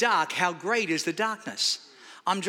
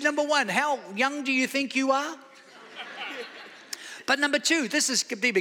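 laughing; 5 semitones higher than usual. Retold or imitated. Doctor's teasing reply quoted.

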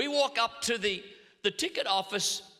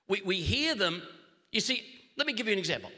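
normal talking voice

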